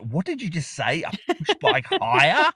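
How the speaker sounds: high-pitched, drawn-out incredulous quote; amazed. Retold or imitated. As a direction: mimic a baffled friend, pitch climbing. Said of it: "Josh was amazed by that too".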